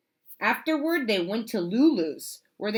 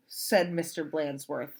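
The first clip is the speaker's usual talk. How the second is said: ordinary delivery, nothing marked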